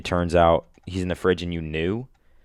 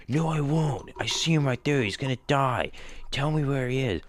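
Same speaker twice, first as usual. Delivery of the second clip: higher, pleading cop voice; a cop panicking for his son, higher and urgent; frantic cop; 6 semitones higher than usual. Retold or imitated. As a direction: play the desperate cop begging. Cop's frantic reply retold.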